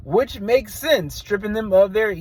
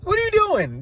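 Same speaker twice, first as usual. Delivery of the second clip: mock pompous promo. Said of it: "it comes off as".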